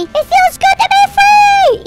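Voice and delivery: high, loud, drawn-out cry of freedom; jubilant shout. Pretend. Gamer celebrating being free as a fish, jubilant shout. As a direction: belt it out slowly and high.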